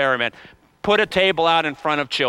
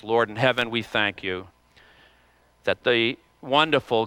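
prayer; 4 semitones lower than usual. Acted. Closing prayer.

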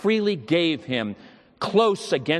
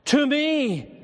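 much higher, pleading and full; intense plea; 12 semitones higher than usual. Retold or imitated. Dying boy adding to me himself, intense. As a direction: claim the words for himself. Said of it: "most intense with earnestness".

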